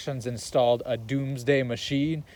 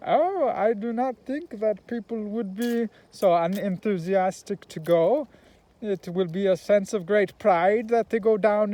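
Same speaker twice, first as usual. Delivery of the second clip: Doctor Strangelove voice; 5 semitones higher than usual. Retold or imitated. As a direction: imitate the ex-Nazi scientist in a thick German accent, drawing it out. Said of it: "And he talks like this".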